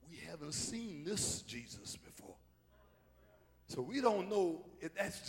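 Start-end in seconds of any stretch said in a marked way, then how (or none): 0.1-2.4 hushed awe. Acted. Hushed disciples' amazement.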